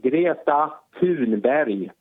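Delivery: Greta Thunberg said the native Swedish way; Swedish pronunciation. Shown as a demonstration. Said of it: "Say her name in Swedish again".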